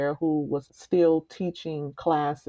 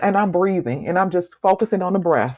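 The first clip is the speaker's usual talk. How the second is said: ordinary talk, nothing marked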